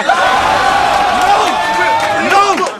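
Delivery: high, loud mocking "No!"; shouted no. Rapper jeering at his rival, loud mocking shout. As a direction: bark the word out twice, jeering.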